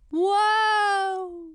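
very high, loud squeak; squeaky wow. Acted. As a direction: gasp it out in delight. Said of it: "said Chlorine".

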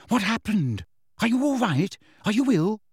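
high, anxious run of questions; frightened. A narrator's reading. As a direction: ask in alarm, pitched well up. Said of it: "looking very frightened".

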